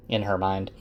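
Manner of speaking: normal talking voice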